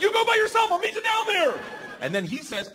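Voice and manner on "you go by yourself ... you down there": very high shouted plea; acted-out panic, yelled; frantic refusal. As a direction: holler it desperately over the wind. Frantic refusal at plane door.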